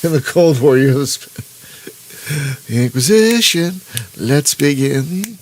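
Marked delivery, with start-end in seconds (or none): 2.7-5.4 lightly voiced Inquisitor from the Mel Brooks bit; a hint of a theatrical Inquisitor, slow on "Let's begin"; Mel Brooks Inquisition bit. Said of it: "The Spanish Inquisition from History of the World".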